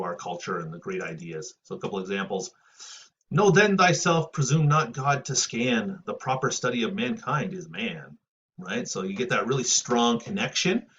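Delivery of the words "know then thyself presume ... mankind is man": lofty recital, opening high and loud; poem recitation. Acted. Teacher recites Pope heroic couplet to class, declaimed. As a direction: declaim it like elevated verse.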